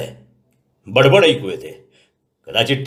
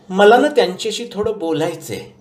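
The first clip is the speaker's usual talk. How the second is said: much higher granny voice, insistent; old grandmother; 10 semitones higher than usual. A narrator's reading. Grandmother telling grandson she wants to talk, insistent. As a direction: insist like a determined old lady.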